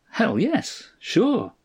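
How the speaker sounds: high-pitched, ready agreement; neighbour on phone. A narrator's reading. Farmer answers Stanley by phone, high and emphatic. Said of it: "Smitty said".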